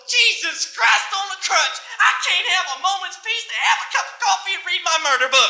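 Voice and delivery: high, loud exasperated Southern mom; hammed-up holler at noisy kids; sudden Southern outburst. Southern mom snapping at rackety kids, loud mock outburst. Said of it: "she'd all of a sudden go".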